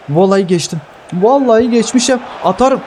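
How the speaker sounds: thrilled he got past; excited disbelief. Real, not acted.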